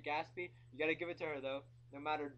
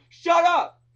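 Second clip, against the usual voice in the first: very loud, high-pitched scream; sudden furious shout; full-throated yell; 14 semitones higher, 25 dB louder than usual. Acted. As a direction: explode at the alarm clock. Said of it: "and I just wanna yell".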